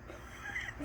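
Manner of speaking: a high, breathless burst of laughter; a sudden high, airless laugh; real laugh. Real, not acted. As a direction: laugh helplessly at the joke. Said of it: "oh, he had me dying".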